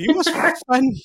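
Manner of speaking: loud, high burst on was; excited exclamation. Real, not acted. Host recalling the late Wesley to his sister, loudly delighted.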